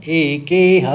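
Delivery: "एकेह" loud, with the last ह fully voiced; full final ह. Shown as a demonstration. Teacher models "एकेह" for learners, final ह fully pronounced. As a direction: sound the whole ह ending "एकेह". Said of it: "ह का संस्कृत में पूरा उच्चारण करना है".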